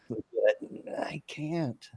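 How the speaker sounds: breathy whisper through laughter; laughing whisper. Real, not acted. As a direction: giggle it out, hushed. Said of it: "laughing whisper".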